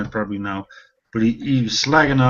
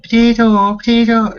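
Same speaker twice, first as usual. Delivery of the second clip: put-on high, loud Irish-accent taunt; mocking Irish accent; 11 semitones higher, 7 dB louder than usual. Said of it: "they hear the Irish accent".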